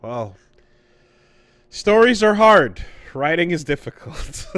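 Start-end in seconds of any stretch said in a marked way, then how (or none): 1.7-4.5 sarcastic. Acted. Sarcastic remark on writing.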